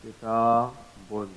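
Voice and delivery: kitabun slowly, syllable by syllable; slow model pronunciation. Shown as a demonstration. Teacher repeating kitabun slowly for the class. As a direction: drag out kitabun so the noon is heard. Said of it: "as if we are pronouncing it like this".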